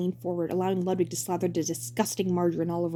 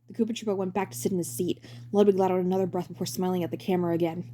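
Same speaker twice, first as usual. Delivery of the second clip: ordinary delivery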